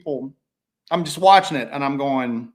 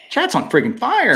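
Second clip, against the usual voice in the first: excited; 9 semitones higher, 7 dB louder than usual. Real, not acted. Excited about the live chat.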